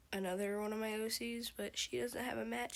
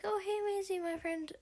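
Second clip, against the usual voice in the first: mock-excited Avelia calling out to a friend; excited greeting; 11 semitones higher than usual. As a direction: greet a friend with a delighted high-pitched squeal. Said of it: "Avelia's like".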